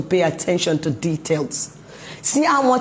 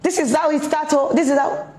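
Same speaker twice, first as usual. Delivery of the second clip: higher, scolding parent quote; nagging parent; 6 semitones higher than usual. Retold or imitated. Parent scolding a teen about mirror time, higher nagging voice. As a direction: play the exasperated mom nagging her teen. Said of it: "Or they're just saying".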